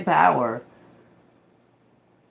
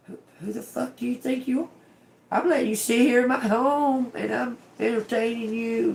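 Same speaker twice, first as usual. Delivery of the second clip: high, fast indignant rant; indignant outrage; 8 semitones higher than usual. Pretend. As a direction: tell off a rude guest in your home. Acted outrage at disrespectful guest.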